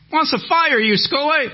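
high, loud holler to Schoolie; hollered public announcement; yelling across room. Retold or imitated. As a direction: announce it at a yell to the whole shop. Man yells across the room.

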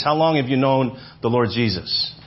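ordinary delivery

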